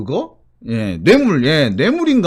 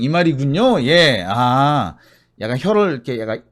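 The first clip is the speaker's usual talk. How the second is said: ordinary talk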